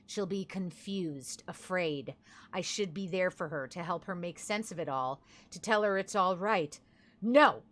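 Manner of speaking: understated dramatic reading; reading a diary. Acted. Reads character's diary lines.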